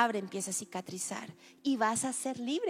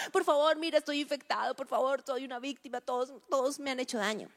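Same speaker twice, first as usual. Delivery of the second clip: pleading victim; 3 semitones higher than usual. Retold or imitated. Preacher imitating victim begging God, plaintive. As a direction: beg like a wounded victim.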